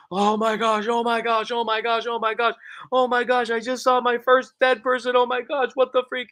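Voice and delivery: high, panicked babble of oh my gosh; breathless panic at seeing a dead body; freaking out. Retold or imitated. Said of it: "And I'm freaking out".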